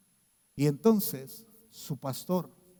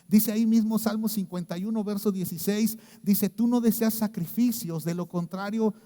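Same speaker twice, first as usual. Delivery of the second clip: higher, louder scripture proclamation; full-force preaching; 7 semitones higher, 7 dB louder than usual. Acted. Preacher reads Psalm 51 to the congregation, proclaiming.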